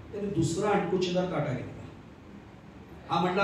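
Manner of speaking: ordinary talk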